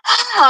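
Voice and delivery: retold scream. Alone with him shot, a high scream. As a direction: scream out in shock. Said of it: "I'm screaming".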